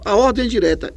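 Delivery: a hint of a puzzled student; imagined student. Teacher voicing a student's doubt, mildly puzzled.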